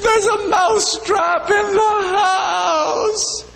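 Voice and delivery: very high, slow crying mouse voice; frantic mouse cry. A narrator's reading. Mouse warning friends outside the house, high slow crying wail. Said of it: "he says".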